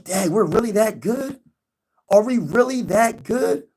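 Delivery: wide-eyed fans who can't believe it; stunned fan asking if it's real; amazed fans. Retold or imitated. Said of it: "You're gonna be like".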